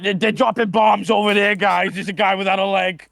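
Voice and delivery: put-on lightly Boston-accented Adam Sandler urging guys; Boston-accent Sandler in a war scene. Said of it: "really strong Boston accent for his Adam Sandler impression".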